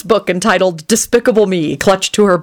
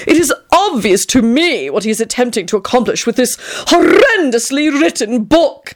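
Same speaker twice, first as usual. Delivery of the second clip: supercilious; 6 semitones higher than usual. A narrator's reading. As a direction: look down on it with theatrical disdain. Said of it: "Tony continued superciliously".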